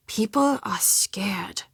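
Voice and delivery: slow, breathy whisper of fear; hushed whisper. Acted. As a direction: lean in and whisper it slowly. Telling a frightening local rumour.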